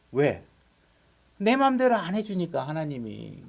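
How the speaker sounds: ordinary delivery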